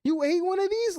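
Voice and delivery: high, drawn-out gasp of alarm; shocked mom. Retold or imitated. Mom discovering her son ate a raw tamale, high and shocked. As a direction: stretch the oh my gosh high and slow. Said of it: "she's like".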